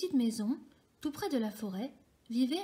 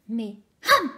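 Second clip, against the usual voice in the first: loud gulp; 10 dB louder than usual. A narrator's reading. Fox gulps the galette.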